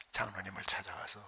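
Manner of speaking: ordinary talk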